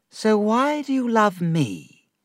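high, slow, wistful Dora; a touch of hurt jealousy; plaintive question. Acted. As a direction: Dora asking David, lightly plaintive.

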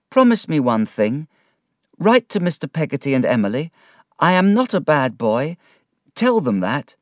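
a lightly voiced boy, urgent and earnest; young boy pleading. A boy begging his nurse to tell his family, lightly voiced earnest plea. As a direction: plead with her like a boy being sent away.

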